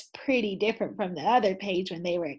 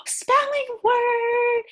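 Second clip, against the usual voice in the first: high, loud and slow, calling out the spelling word; excited announcement; 14 semitones higher, 6 dB louder, much slower than usual. A narrator's reading. Teacher cat calls the class to a spelling word, high and drawn out.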